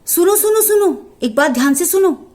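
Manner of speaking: put-on high, loud call to the children; mother goat calling her kids to listen, loud and high. (ऊँची आवाज़ में बच्चों को पुकारती माँ)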